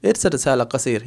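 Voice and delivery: इरसिल Risala कसीर run together smoothly; connected, everyday flowing phrase; simple, in flow. Shown as a demonstration. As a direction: say it in one flow, dropping the final -a of कसीर. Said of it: "flow में बोल सकते हैं".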